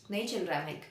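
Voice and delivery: normal talking voice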